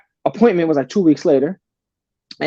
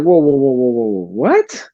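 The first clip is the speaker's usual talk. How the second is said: retold disbelief; 4 semitones lower, slower than usual. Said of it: "And I'm like".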